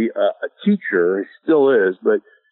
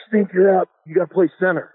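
raised, higher call to a teammate; urgent sideline call, pitched up; calling him in; 6 semitones higher than usual. Retold or imitated. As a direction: shout him off the bench.

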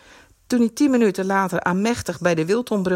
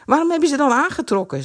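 fast, raised irritated retort; annoyed; 8 semitones higher, 4 dB louder, faster than usual. A narrator's reading. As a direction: snap back at her. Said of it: "zei Kees nijdig".

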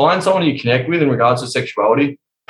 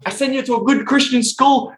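imitating mum; 10 semitones higher than usual. Mum voiced in story.

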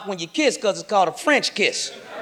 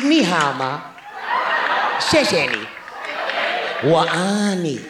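mock Chinese; 5 semitones lower than usual. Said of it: "if you're Chinese".

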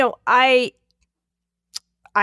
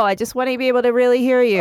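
ordinary talk, nothing marked

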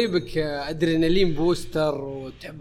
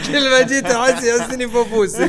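higher and louder, laughing as he talks; giggling while trying to finish the joke; laughing through words; 9 semitones higher, 10 dB louder than usual. Real, not acted. Cracking up mid-sentence.

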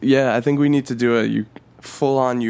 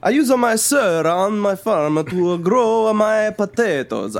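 put-on higher hammy Ukrainian accent; 7 semitones higher than usual. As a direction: ham up a Ukrainian farmer. Joking Ukrainian voices.

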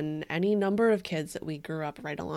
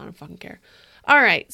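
ordinary talk, nothing marked

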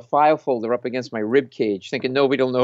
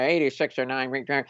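imitated police radio chatter; garbled dispatcher noises; police walkie-talkie; 4 dB quieter than usual. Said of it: "Do you hear their walkie-talkies?".

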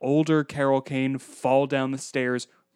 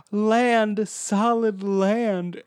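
Lulu; 8 semitones higher, much slower than usual. Retold or imitated. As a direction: imitate a character overjoyed to reach land, stretching each word. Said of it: "the Lulu is like".

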